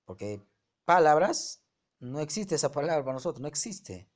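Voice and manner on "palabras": "palabras" with a loud, high PA; wrongly stressed PÁ-la-bras, as a demo; stress on the first syllable. Shown as a demonstration. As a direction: hit the start of "palabras" hard: PÁ-labras. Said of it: "La mayor fuerza está en la pa".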